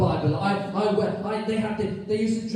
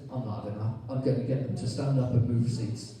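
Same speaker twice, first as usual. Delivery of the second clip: voicing Jesus; 10 semitones lower, 4 dB quieter than usual.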